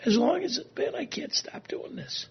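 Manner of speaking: whispered, breaking with real tears; real crying. Real, not acted. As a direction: fight the tears and lose. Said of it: "here come the tears".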